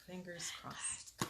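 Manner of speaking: soft unvoiced whisper of hope. Real, not acted. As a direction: breathe it as a hushed hope. Hushing kids before reading.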